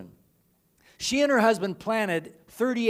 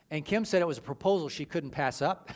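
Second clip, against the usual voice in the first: ordinary talk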